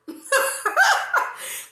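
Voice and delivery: high airy squeal of laughter. Real, not acted. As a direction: laugh up high, almost breathless. Laughing at herself.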